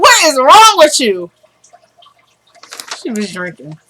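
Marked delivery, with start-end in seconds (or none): 0.0-1.3 loud, high-pitched outburst; exasperated disbelief. Retold or imitated. Reader reacting to the character's taunts, loud high exclamation. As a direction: cry out in appalled disbelief. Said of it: "I was like".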